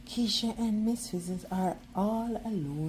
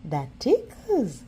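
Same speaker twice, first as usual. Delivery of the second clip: laughing; 10 semitones higher, 7 dB louder than usual. A narrator's reading. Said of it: "laughs Arnold".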